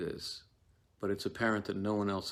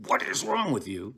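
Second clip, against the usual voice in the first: exasperated hiss; 6 semitones higher than usual. Shown as a demonstration. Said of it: "I am going to say something like".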